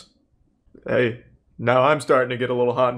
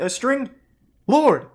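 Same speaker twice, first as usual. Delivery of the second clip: ordinary talk, nothing marked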